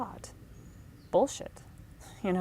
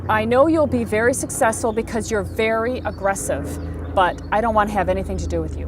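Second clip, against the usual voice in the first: louder, emphatic brush-off; retold firm rejection; 2 semitones higher, 8 dB louder than usual. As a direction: deliver a retold, no-nonsense refusal. Retold blunt rejection on phone.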